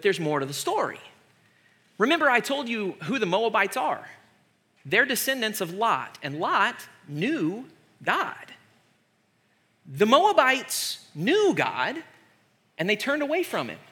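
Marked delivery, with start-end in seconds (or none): none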